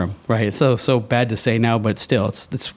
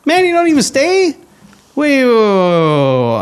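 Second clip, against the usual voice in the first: high, loud startled outcry trailing off; retold disbelief; 13 semitones higher, 5 dB louder than usual. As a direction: yelp it as he speeds away, then fade. Pastor drives off, retold shock.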